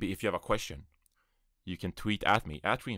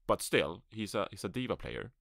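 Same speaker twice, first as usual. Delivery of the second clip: ordinary talk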